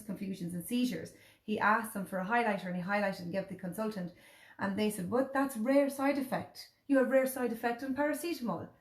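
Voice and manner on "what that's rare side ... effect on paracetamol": dismissive doctor. Retold or imitated. Mother mocking the consultant's dismissal, mild mock voice. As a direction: mimic the doctor waving it away.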